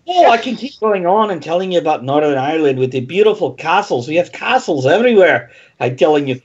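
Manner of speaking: rolling, lilting mock Irish; hammed-up Irish tour patter; Northern Irish accent. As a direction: put on a wandering Irish brogue. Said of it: "if I keep doing this".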